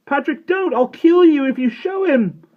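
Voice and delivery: very high, loud desperate scream. A narrator's reading. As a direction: scream at the top of your lungs. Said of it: "he screamed at the top of his lungs".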